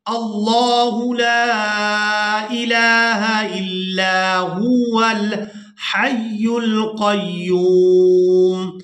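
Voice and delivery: slow, melodic tilawat, drawn out; Quran recitation. Shown as a demonstration. Teacher reciting the verse to students, slow sung tilawat. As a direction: chant each word long and sung.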